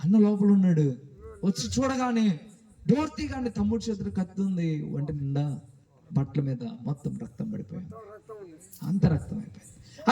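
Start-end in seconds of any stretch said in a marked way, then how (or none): none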